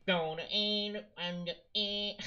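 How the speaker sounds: nasal [name] voice. Retold or imitated. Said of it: "nasal [name] voice".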